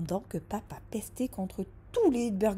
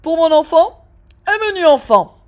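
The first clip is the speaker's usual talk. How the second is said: loud, higher, deliberate order; a father ordering loudly on purpose; pointed dad; 9 semitones higher, 18 dB louder than usual. Acted. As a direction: stress the point, like dad making a stand.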